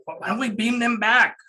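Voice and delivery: high, youthful suggestion; eager youngster's high voice; high-pitched young crewman. Acted. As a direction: pitch it up like a very young crew member. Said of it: "he's got more of a high-pitched voice".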